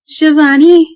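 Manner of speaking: high, loud call across the woods. A narrator's reading. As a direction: shout her name to find her. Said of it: "Uncle Ram called".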